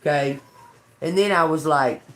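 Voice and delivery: ordinary talk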